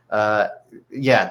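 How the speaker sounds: ordinary delivery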